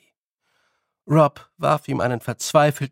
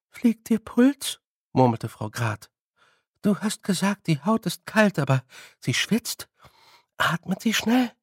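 put-on frail old woman; 5 semitones higher, 3 dB louder than usual. Frau Grad voiced.

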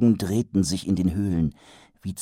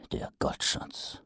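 whispered thought; 13 dB quieter, slower than usual. Acted. Mumm's thought.

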